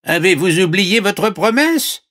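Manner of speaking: very high frog voice; squeaky-high frog offering help; talking frog. Acted. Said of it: "dit la grenouille". (voix de grenouille très aiguë)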